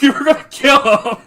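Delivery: high, loud gleeful shout; hyped yell. Retold or imitated. Gamer cheering as Alphinaud vows to kill, gleeful shout. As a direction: shout it like a rallying cry.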